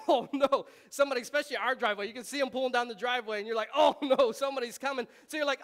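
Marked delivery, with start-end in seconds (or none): 0.1-0.7 mock dismay. Dreading a visitor arriving. 3.7-5.1 mock panic. Dreading a visitor arriving.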